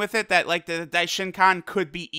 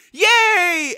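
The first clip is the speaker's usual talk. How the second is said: put-on high, loud childlike yay; mock joyful yay; joyful cheer; 11 semitones higher, 6 dB louder than usual. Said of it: "so joyful".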